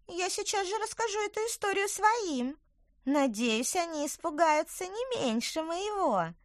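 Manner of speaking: high, bright little woodland creature; chirpy tiny creature, earnest; little apple creature. Acted. Tykver sly.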